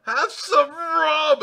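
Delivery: loud, high, drawn-out pirate bellow; Jack Sparrow. Acted. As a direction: roar the offer, stretching each word. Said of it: "Jack Sparrow goes".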